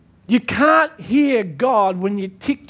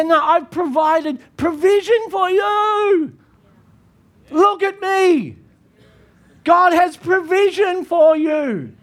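fiery preaching; 6 semitones higher than usual. Real, not acted. Pastor declaring provision to the congregation, fiery and slow. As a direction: proclaim it over the room.

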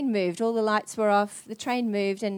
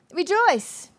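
voice of the Lord; 7 semitones higher than usual. Retold or imitated. Lord speaks in retold story.

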